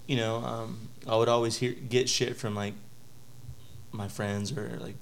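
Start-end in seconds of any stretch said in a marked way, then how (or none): none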